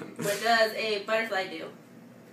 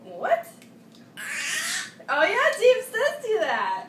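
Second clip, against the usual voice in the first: surprised; 11 semitones higher, 4 dB louder than usual. Acted. Mock surprise to toddler.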